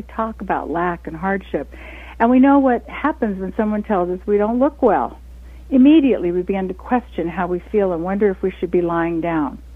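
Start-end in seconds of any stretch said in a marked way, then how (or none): 5.7-6.3 Immediately lifted high and much louder; punched word. Acted. Host punches Immediately to show the sudden reaction, loud and high. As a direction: hit Immediately hard.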